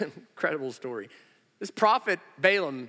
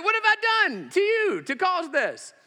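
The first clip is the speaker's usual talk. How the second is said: high, loud, drawn-out protest; indignant donkey; 5 dB louder than usual. Acted. Preacher as donkey asking Balaam the cause, hammed-up indignation. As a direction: plead your innocence as an outraged donkey.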